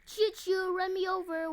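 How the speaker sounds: high, loud playful train call; mock train whistle voice; choo choo train. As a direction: toot like a little train begging to be hit.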